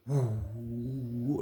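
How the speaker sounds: long, slow hollow 'woo'; drawn-out howl of wind; imitated tornado wind. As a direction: moan like wind starting up. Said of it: "It was trying to do it".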